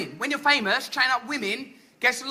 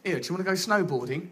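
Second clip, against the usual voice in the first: casual offer; 8 semitones lower, 6 dB quieter, faster than usual. Retold or imitated. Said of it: "Someone might come up to you and go".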